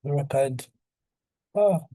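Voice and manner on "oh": sudden high, loud oh; surprised oh. Real, not acted. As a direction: catch sight of it, startled. Sees price retest.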